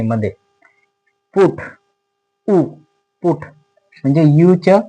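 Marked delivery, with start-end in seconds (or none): 1.4-3.4 पुट with the vowel उ pulled out alone between two takes; short u in put. Shown as a demonstration. Teacher showing pupils the short उ in पुट. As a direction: say पुट, isolate the उ, then पुट again. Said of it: "उ असा उच्चार होतोय".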